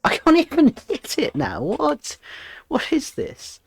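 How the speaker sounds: startled laughing squeal; shocked outburst. Real, not acted. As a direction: yelp it out in disbelief. Shocked at failed jump.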